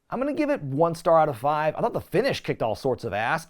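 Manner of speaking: ordinary talk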